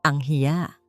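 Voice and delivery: ordinary talk, nothing marked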